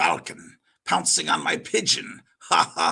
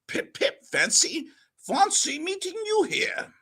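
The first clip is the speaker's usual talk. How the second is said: higher mock British pip-pip; British toff. Churchill greeting old friends in mock British accent. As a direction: ham up the English gent.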